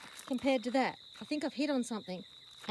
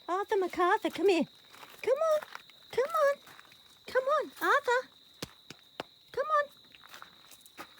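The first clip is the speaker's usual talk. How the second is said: high, loud sing-song calling; 5 dB louder, slower than usual. Real, not acted. Owner calling her horse Arthur, high and loud. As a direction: coax the horse over in a high voice.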